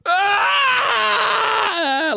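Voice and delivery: high wailing cry, hammed-up; mock toddler wail. Dad acts out his son's meltdown as a high wail. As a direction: let out a child's meltdown scream.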